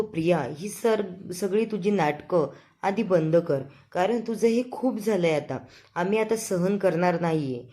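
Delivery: usual speaking voice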